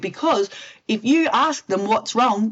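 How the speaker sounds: usual speaking voice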